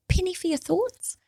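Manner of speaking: put-on high, gentle old grandmother; grandma comforting her granddaughter, high and gentle; grandma's voice. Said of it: "she puts her hand on my lap and she goes".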